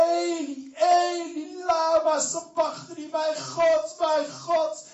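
high, shouted, slow lament; anguished cry. Acted. Jesus on the cross cries out to his Father, shouting. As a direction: cry out to God in agony. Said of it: "riep hij".

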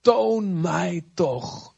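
Toon mij toch drawn out very slowly; slow prayerful plea. Acted. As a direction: stretch each word like a longing prayer. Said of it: "Mozes zegt".

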